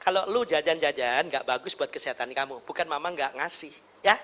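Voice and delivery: put-on low, soft reasoning with a child; gentle mother. Shown as a demonstration. Mother explains to child why no snack money, calm and soft. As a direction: explain it quietly and patiently. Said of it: "Bilang aja".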